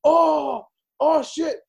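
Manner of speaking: high, loud oh shit; mock panic. The tour manager caught by the Ghoul guy, high startled panic. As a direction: caught red-handed.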